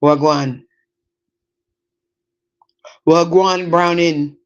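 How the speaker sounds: a touch of Caribbean patois greeting; Caribbean accent. Acted. Showing what hanging out in Flatbush does, Caribbean accent. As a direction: greet them with a Caribbean lilt. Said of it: "I hear a Caribbean accent too".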